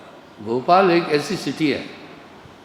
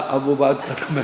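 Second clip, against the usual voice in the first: imitating little brother's echo. Little brother repeating elder's words, toned-down echo. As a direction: repeat it back tamely, deadpan mimicry. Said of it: "बोला".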